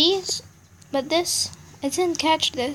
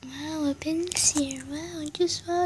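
breathy whispered wow; sarcastic whisper at a copied game; 7 dB quieter than usual. Real, not acted. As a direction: hush it, deadpan amazement.

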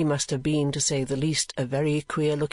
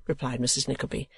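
plain reading voice

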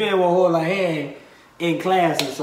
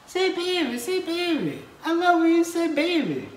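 high, excited mock voice of a Texan asking; gushing, pitched way up like a fan; imitating Texans; 10 semitones higher than usual. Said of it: "When I was down in Texas".

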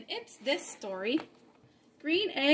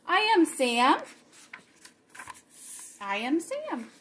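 lively read-aloud with bouncy rhymes; story reading for children; 8 dB louder than usual. A narrator's reading. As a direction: read the rhymes to the kids with fun. Reads Green Eggs and Ham aloud.

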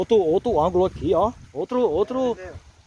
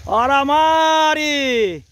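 slow, louder, rising "Olha a Mari"; drawn-out call; 4 semitones higher, 5 dB louder, much slower than usual. Real, not acted. Man cheering on his cousin Mari, slow and loud.

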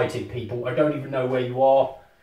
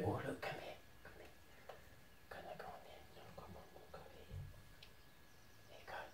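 hushed coaxing whisper; 5 semitones lower, 29 dB quieter, much faster than usual. Real, not acted. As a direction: whisper softly to a timid creature.

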